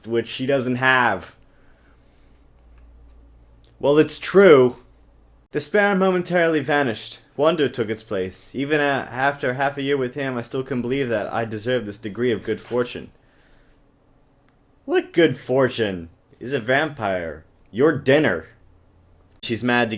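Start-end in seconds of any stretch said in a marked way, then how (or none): 3.8-4.8 louder, higher insistent retort; loud defensive well it's true; emphatic. Real, not acted. 14.8-16.1 slow, scornful what good fortune; slow mocking disbelief; sarcastic. Real, not acted. Sarcastic retort.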